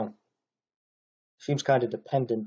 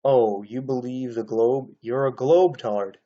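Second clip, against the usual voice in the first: mocking quote. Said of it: "someone said".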